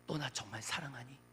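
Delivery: suddenly low and soft; hushed, probing divine question; the Lord's searching question. Retold or imitated. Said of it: "주님께서 그 에베소 교회에게 말씀하셨던 것처럼".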